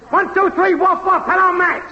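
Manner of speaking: high-pitched, singsong mic-test chant; woofing mic-test yell. Acted. Technician testing if the show is on air, high woofing yell. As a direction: chant it high, evenly, like barked test words. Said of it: "woof woofing and yelling".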